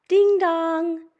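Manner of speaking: high, loud ringing doorbell; sung-out high doorbell sound; doorbell imitation. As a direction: chime it out like a bell.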